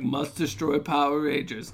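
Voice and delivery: slow, mock menacing monster line; lightly voiced movie cyborg, dragged out; villain robot voice. Quoting the Lizinator monster, slow mock robot menace. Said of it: "He, like, appears as, like".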